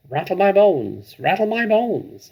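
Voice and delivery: high, slow, eerie parrot croak; spooky parrot voice. Acted. Parrot showing off its spooky voice, high and slow. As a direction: stretch it out like a haunted bird. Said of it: "soft and spooky this time".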